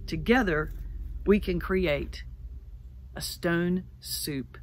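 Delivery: slow, measured sermon close fading to a whisper; solemn recitation. Acted. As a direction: land the blessing slowly and gently. Closing recited recipe blessing.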